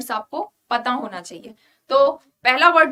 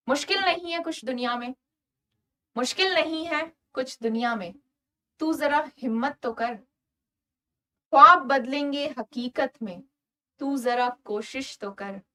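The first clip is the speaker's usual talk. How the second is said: poem recitation. Acted. Recites motivational lines.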